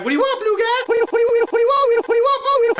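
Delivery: high, squeaky, repetitive challenge; feisty pink bear. Acted. Pink one answering blue guy, high-pitched squabbling. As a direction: fire back over and over in a high cartoon voice.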